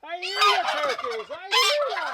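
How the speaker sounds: high teasing call back to the turkeys; talking to the turkeys. Real, not acted. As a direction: answer the gobbling like a chatty pet owner. Answering the gobbling turkeys.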